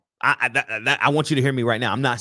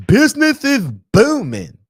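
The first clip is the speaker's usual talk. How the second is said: mocking voice; 12 semitones higher, 8 dB louder than usual. Mocking opposing coach, loud high.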